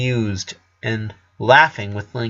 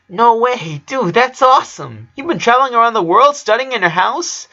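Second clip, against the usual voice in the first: much higher, louder, gushing amazement; thrilled boy; 12 semitones higher, 5 dB louder than usual. Acted. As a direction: burst out with wide-eyed delight. Lincoln thrilled by travel story.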